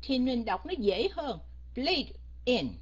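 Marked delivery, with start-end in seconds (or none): 1.8-2.8 BLADE cut off, then a separate IN; cut apart. Shown as a demonstration. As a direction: stop after Blade, then say in. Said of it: "mình phải cắt nó ra". (Blade, ngắt ra, rồi in)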